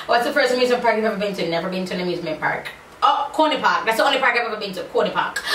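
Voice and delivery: ordinary talk, nothing marked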